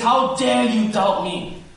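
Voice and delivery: indignant judge. Acted. God as judge rebuking Sarah's doubt, indignant. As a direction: thunder it as an outraged judge. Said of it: "huge judge and says".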